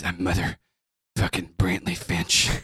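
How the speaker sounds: very low, slow declaration; Brantley, grandly self-important. Acted. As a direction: announce yourself softly and deeply, with dramatic pauses. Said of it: "And he goes".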